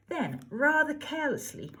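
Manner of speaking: ordinary delivery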